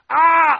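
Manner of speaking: high, loud quarrel scream; acted screaming-match cry; imitated yelling. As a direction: yell to outshout a spouse. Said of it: "y gritar más".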